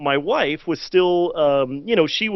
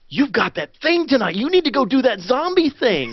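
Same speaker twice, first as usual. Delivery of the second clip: wife's urging; 7 semitones higher than usual. Retold or imitated. Wife voiced in story.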